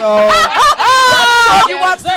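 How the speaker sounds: high, slow, laughing yell; laughing shout. Real, not acted. Laughing shout mid-sentence.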